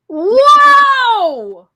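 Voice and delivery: loud, high gasp of shock; a big stunned exclamation; startled cry of disbelief; shocked whoa. Real, not acted. Reacting to suicide survival story.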